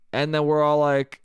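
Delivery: ordinary talk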